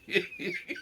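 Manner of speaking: high squeak of laughter. Real, not acted. Squeaking with laughter at the story.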